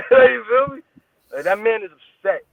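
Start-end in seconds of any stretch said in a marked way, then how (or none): 0.1-0.8 laughing out the question, high and loud; giggly, raised-pitch you feel me. Real, not acted. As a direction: burst out laughing as you ask.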